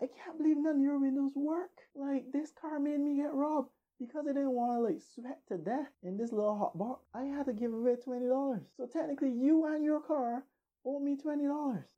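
high-pitched, exasperated blame; indignant comeback. Acted. Narrator blaming girlfriend's car for the robbery, high indignant comeback. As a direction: argue back in mock outrage, voice held way up. Acted quarrel back at upset girlfriend.

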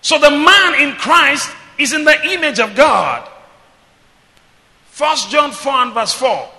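loud, high-pitched sermon delivery; full-force preaching. Real, not acted. Preacher leading the church in reading scripture, full-force preaching.

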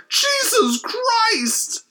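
high, drawn-out startled cry; George McFly yelp. Retold or imitated. Husband kissed by corpse in grave, high slow startled cry. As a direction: stretch the shock out high and slow. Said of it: "He goes full George McFly".

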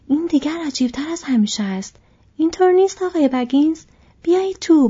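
rising with astonished welcome. A narrator's reading. Farmer greets Frodo Baggins with loud astonished surprise. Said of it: "شگفت زده گفت".

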